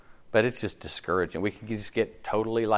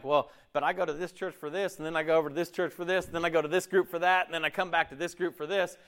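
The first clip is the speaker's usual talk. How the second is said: rattled-off list; 7 semitones higher, faster than usual. Acted. Voicing a busy church hopper.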